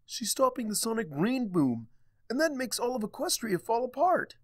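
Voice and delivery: high, fast panicked outburst; little dragon crying out in panic; alarmed cry. A narrator's reading. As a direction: blurt it out in a rush of alarm. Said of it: "Spike cried".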